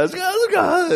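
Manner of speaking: high, tearful, panicked; crying out. Retold or imitated. Beaten husband crying to the other pastor. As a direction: sob it out in a panic.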